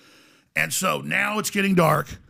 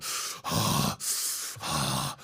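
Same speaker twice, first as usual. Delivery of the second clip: long, repeated voiceless exhales; enraptured heavy breathing; 10 dB quieter than usual. Retold or imitated. Storyteller shows how the old men watched the ritual, heavy rapt breathing. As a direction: imitate rapt worshippers breathing out slowly. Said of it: "they're, like, enraptured".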